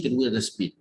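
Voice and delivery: normal talking voice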